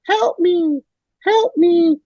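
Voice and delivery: tiny high pleading voice; little voice. Retold or imitated. Retelling The Fly's famous ending, tiny high plea. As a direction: squeak it like a tiny trapped fly man. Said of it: "you just hear his little voice going like".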